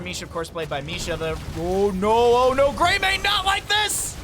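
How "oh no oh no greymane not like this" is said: rising to a high, loud cry; building play-by-play panic; alarmed caster shout. Real, not acted. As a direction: wail it higher and louder as disaster unfolds. Caster reacts to Greymane in danger.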